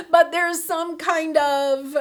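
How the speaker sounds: loud real laugh spilling into words; laugh, then talk slowly through it. Real, not acted. Laughs at own joke.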